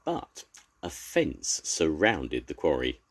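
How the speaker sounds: plain reading voice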